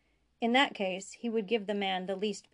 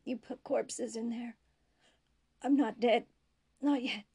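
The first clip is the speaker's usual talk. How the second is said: desperate dying man; 4 semitones higher than usual. A narrator's reading. Prisoner insists to Bashir he is still alive, desperate. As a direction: push back in desperation, voice pitched up.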